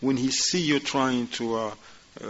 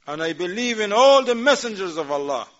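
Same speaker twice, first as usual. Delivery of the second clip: much higher and louder, a strong declaration; raised, emphatic; 8 semitones higher, 5 dB louder than usual. Shown as a demonstration. Teacher models the believer's answer, raised. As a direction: proclaim it with conviction. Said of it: "And you say".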